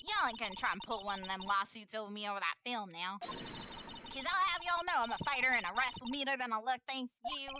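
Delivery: defiant Southern country voice; exaggerated Southern drawl. Woman bristling at a lawyer, Southern drawl. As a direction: warn them off in Southern. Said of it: "she has an exaggerated drawl".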